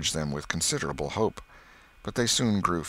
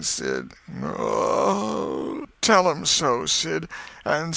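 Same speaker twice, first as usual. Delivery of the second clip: moaning, mostly high deathbed voice; hammed-up invalid's farewell; feigned dying; 8 semitones higher than usual. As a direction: groan out last wishes like a martyr. Tom's mock deathbed farewell.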